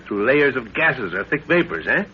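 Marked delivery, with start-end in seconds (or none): none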